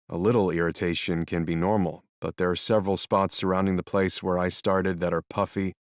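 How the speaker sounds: usual speaking voice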